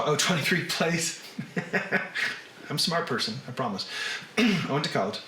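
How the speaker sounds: normal talking voice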